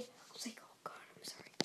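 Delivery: hushed whisper to not be heard; breathless whisper while sneaking. Real, not acted. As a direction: whisper it so nobody hears. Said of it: "unvoiced whisper".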